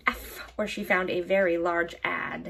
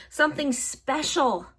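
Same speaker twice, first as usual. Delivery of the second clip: something high and leaned on; stress on something; 5 semitones higher than usual. A narrator's reading. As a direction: lean on "something".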